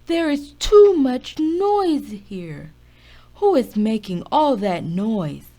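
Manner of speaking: Father Bear roars at the noisy friends; roared. A narrator's reading. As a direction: bellow at the noisy room. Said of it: "Father Bear roared".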